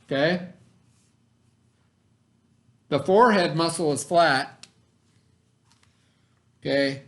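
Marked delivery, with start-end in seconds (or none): 2.9-4.6 put-on clear, deliberate read-aloud voice for kids; reading to children. Teacher reads the science book to her students, storytime voice.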